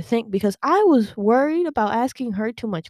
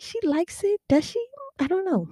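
nervous hope; 12 semitones higher, 3 dB quieter than usual. Retold or imitated. Hopeful uncertain inner thought.

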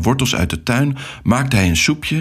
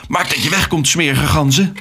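angry defiance; 7 semitones higher than usual. A narrator's reading. Alex orders the attacking geese away, angry and high. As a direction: drive them off in a high voice.

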